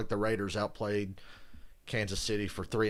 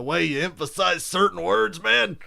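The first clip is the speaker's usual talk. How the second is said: emphatic coach impression, higher and louder; John Gruden; 8 semitones higher, 7 dB louder than usual. Shown as a demonstration. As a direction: hit certain words hard, rising into excitement. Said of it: "then that kinda sounds like Romo".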